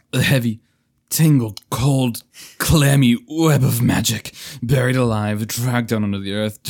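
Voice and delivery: loud, tormented lament; anguished enchanted knight. Acted. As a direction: cry out as a cursed prisoner.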